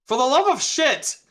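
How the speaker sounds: loud, high cry of frustration; exasperated outburst. Real, not acted. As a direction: burst out, fed up with the dice. Frustrated at a bad roll.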